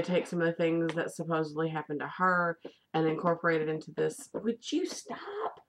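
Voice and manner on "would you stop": high, slow, pleading scold. Real, not acted. Scolding Luna for playing with her hair, high and slow. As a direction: squeak it out, telling her to quit.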